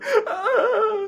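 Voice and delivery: very high, loud imitated sobbing; mock crying wail. Wife bawling down the phone, mock crying. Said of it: "I understand that cry anywhere".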